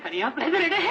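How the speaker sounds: rising, hammed-up wail; wailing self-talk. Poet frightened at talking to himself, rising wail. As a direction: let the realisation climb into a whimpering cry.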